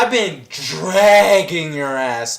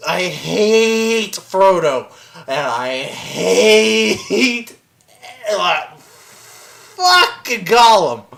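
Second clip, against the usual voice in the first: very slow, drawn-out disgust; emphatic loathing; much slower than usual. Real, not acted. Fan ranting about Frodo and Gollum, slow loathing. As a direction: stretch the hate out long and heavy. Comic rant of hatred.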